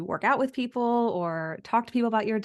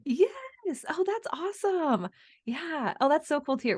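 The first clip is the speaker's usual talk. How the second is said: much higher, thrilled reaction; excited; 8 semitones higher, slower than usual. Real, not acted. Host thrilled to hear about plant-based sushi. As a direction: gush with real delight. Host delighted by guest's news.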